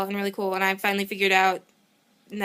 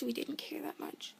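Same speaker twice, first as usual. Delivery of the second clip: whispered aside; 5 semitones higher, 15 dB quieter than usual. Real, not acted. Confessional aside.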